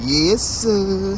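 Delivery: high, drawn-out gleeful cheer; gleeful approval. Real, not acted. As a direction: savor it in a high, delighted voice. Delighted with the ice cream.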